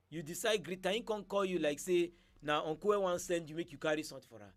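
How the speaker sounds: ordinary talk, nothing marked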